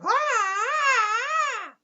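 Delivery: long, high, loud imitated screech; birdlike squawk. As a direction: hold a piercing mock bird cry. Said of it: "like a bird, and just going".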